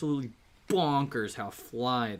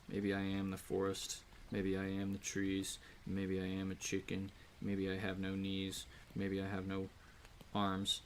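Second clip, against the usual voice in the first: low, soft rhythmic recitation; slam poetry; 3 semitones lower, 7 dB quieter than usual. Acted. Streamer doing quiet slam poetry. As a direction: chant it like a poem.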